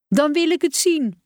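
put-on high, demanding child's cry; insistent call. A narrator's reading. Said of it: "riep Tijmen nog harder".

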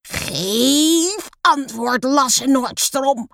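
high, drawn-out furious roar; bellowed demand. A narrator's reading. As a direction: bellow slowly at the pupil, stretching the words. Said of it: "brult de juf opeens met haar rare, zware stem".

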